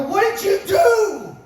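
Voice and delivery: loud, high, slow demand; angry coach. Retold or imitated. Coach to overweight wrestler: slow, loud, high disbelieving demand. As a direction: bark it at the kid who blew his weight.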